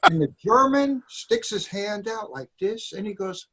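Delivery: ordinary delivery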